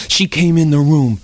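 ordinary delivery

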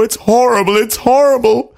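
high-pitched wail of dismay; hammed-up horror, voice pushed up high; distraught king. A narrator's reading. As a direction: lament in a high storybook panic. King distraught over his daughter.

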